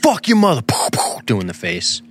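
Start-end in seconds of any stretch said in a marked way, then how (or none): 0.0-0.6 mock yell. Northerner yelling at the man he shoots, hammed-up yell. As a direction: bellow it like a shooter gloating. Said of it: "he's fucking yelling at you".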